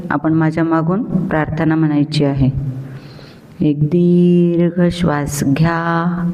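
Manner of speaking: ordinary delivery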